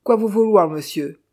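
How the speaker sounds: put-on high, loud accented opening, dropping at the end; loud put-on pidgin French; pidgin-speaking Chinese servant. A narrator's reading. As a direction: answer back as the flustered-sounding servant. Servant answers shouted order in pidgin.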